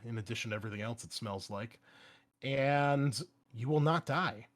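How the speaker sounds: ordinary talk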